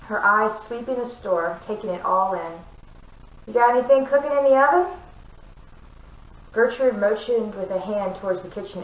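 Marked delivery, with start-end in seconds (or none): 3.5-4.9 loud, fast and teasing; brash witch. A narrator's reading. Brash witch teases loudly.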